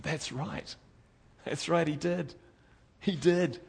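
ordinary talk, nothing marked

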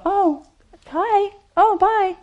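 high, nervous little hi and bye; awkward mock greeting. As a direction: chirp it awkwardly to dodge the talk. Awkward avoidance of sharing Jesus.

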